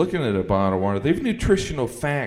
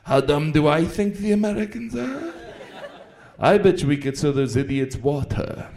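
scheming French marketer; 6 semitones higher, 5 dB louder than usual. Retold or imitated. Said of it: "he's like".

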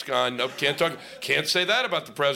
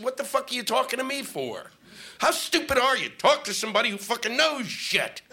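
high, exasperated mock telling-off; mock angry rant; 7 semitones higher than usual. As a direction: rant at the reporter in disbelief. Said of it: "I said".

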